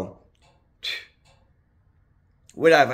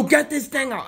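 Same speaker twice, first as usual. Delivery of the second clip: panicked demand; 10 semitones higher than usual. Retold or imitated. Retold freaking out demand.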